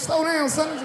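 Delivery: high, sharp shout; scolding son. Retold or imitated. Dad scolds son for eating fast, high sharp shout. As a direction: bark it at your greedy kid. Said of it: "I was like".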